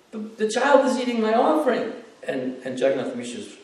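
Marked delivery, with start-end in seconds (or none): 0.1-1.9 much higher, louder indignant shout; alarmed outcry. Retold or imitated. Brahmin calls everyone to see the child eating, high outcry. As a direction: call everyone in, hammed-up alarm. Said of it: "And he goes".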